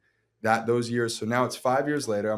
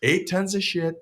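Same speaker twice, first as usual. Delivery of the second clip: high, loud presidential parody; Donald Trump; 9 semitones higher, 8 dB louder than usual. Pretend. Former president roasting a struggling actor, hammed-up impression. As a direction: proclaim it loudly as a mock president. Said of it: "Former president Donald".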